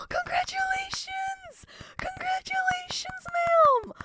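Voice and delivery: high-pitched, squealing burst of delight; excited congratulations. Real, not acted. Streamer congratulating a viewer on news, excited squeal. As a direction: squeal your congratulations, voice leaping way up.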